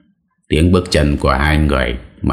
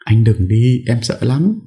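higher, scared wife pleading; frightened plea; 8 semitones higher than usual. Acted. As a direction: hold him back in fear. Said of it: "sợ hãi kéo anh lại". (vợ sợ hãi níu chồng, giọng cao)

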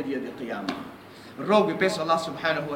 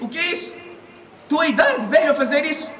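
repreensão severa; 8 semitones higher than usual. Retold or imitated. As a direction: scold the old man in disbelief. Umar rebukes old man drinking. (repreensão indignada, mais aguda e mais forte)

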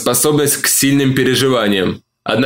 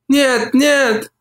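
refusing woman; 11 semitones higher than usual. A narrator's reading. Olivia refuses.

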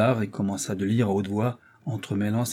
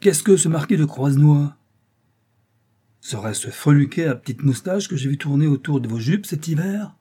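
scornful anger; 5 semitones higher, 6 dB louder than usual. Acted. Father furious about the suitor.

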